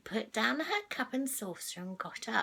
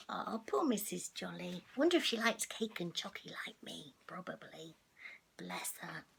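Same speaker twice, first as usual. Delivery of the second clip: hushed aside; 5 semitones lower, 5 dB quieter than usual. Real, not acted. Storyteller to listening children, hushed whisper. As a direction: lean in and whisper sympathetically.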